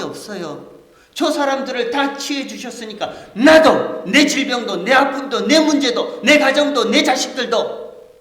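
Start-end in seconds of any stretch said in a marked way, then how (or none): 1.1-8.1 loud, high, rising plea; desperate plea. Acted. Pastor voicing the crowd begging Jesus, loudly. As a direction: beg as if your whole life depends on it.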